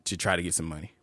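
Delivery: mock talk show host. Posing as host questioning his guest, mock talk show host. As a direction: lightly play a serious interviewer.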